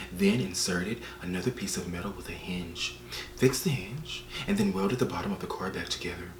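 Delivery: hushed, low, mock-sexy audiobook narration; sultry near-whisper impression. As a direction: read it softly into the ear, trying to sound seductive. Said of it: "she was whispering in my ear".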